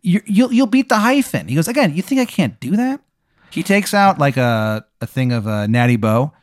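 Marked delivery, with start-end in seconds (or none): 0.0-1.5 high, hammed-up Maryland accent, climbing higher; Wizard of Baltimore. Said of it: "how upsetting this Maryland accent is". 1.7-3.0 very high, bad Maryland accent; Wizard of Baltimore. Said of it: "how upsetting this Maryland accent is".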